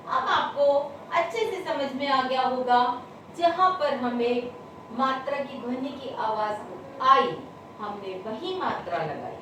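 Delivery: ordinary talk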